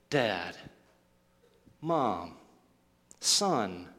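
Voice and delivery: low, soft, intimate; each word dropped gently, with space after it; familiar tone. Acted. A lost loved one on the phone, low and tender. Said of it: "that familiar tone".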